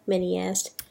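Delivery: usual speaking voice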